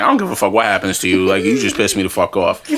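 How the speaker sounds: low, fast, dismissive grumble; low-pitched, pissed-off brush-off; annoyed muttering. Retold or imitated. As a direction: grumble it on your way out. Retelling his annoyed retreat.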